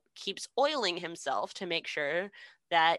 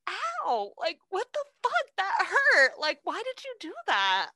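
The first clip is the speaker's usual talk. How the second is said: very high, whiny wounded lion; 12 semitones higher than usual. Retold or imitated. Lion whining at Dorothy after she bops its nose, high and whiny. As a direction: squeal it like a hurt big cat whining. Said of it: "the lion is like".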